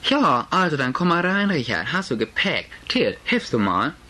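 Mutterstimme, etwas höher und lauter; Mutter. Acted. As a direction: eine leicht aufgeregte Mutter an der Tür. Mutter empfängt Richard. (Mutter bittet Richard herein, leicht gehoben)

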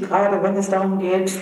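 ordinary delivery

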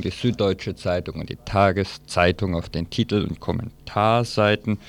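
ordinary talk

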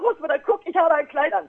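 proud show-off. Retold or imitated.

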